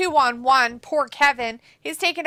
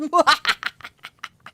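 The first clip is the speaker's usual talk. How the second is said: chuckle; 2 semitones higher than usual. Real, not acted. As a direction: laugh at your own joke. Laughs after own joke.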